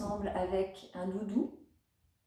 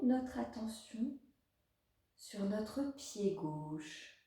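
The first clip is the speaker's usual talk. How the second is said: guided meditation; 4 dB quieter than usual. Acted. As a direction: guide a child gently through a body scan, unhurried.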